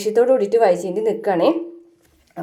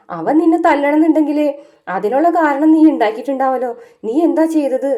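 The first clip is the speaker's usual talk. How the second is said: put-on higher, a little louder old woman scolding; stern grandmother; 6 semitones higher, 3 dB louder than usual. Grandmother questioning Vaijayanthi, mildly stern. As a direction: imitate a grandma demanding answers.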